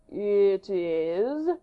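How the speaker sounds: prompting, curious question; inquiring. Acted. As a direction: coax the king to finish. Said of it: "repeated Saint Aignan inquiringly".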